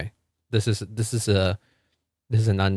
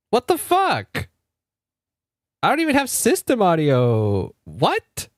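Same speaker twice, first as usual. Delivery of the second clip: high-pitched shocked outburst; genuinely thrown, voice jumps way up; baffled; 9 semitones higher, slower than usual. Real, not acted. Shocked at chat hearing Discord, high squeaky disbelief.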